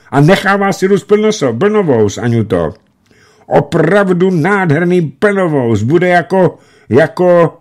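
high, loud and eager; hurried joyful shouting. A narrator's reading. Alexej reassuring Anna excitedly on the phone. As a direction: tumble the words out happily. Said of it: "kříšel překotně do sluchátka".

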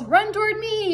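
high-pitched gander call; gander yelling. A narrator's reading. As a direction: yell it high, without much volume. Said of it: "yelled the gander".

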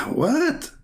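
sudden high squeak of disbelief; high mocking what; incredulous what. Real, not acted. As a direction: jump way up in pitch, stunned. Shocked high question.

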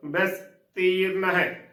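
ਬੈਸਤੀਰਨ drawn out slowly, ਸ closed, long ee after ਤ; correct pronunciation. Shown as a demonstration. Teacher shows the right reading, ਬੈਸਤੀਰਨ slow and careful. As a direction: sound out ਬੈਸਤੀਰਨ slowly as bais-teeran. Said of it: "ਉਚਾਰਨ ਕਰਨਾ ਹੈ".

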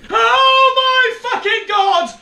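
very high, loud, drawn-out scream; real shriek of terror; terrified scream. Real, not acted. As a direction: scream it out slowly in horror. Jump scare in horror game.

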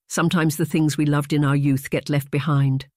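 ordinary delivery, nothing marked

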